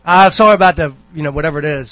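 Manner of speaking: loud, mock-exasperated sorry; exasperated apology. Husband giving in after being told he looks mad, loud apology. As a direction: burst out with a retold, grudging apology. Acted apology to his wife.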